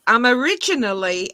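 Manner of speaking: ordinary talk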